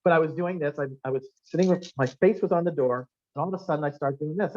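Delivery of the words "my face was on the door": ordinary talk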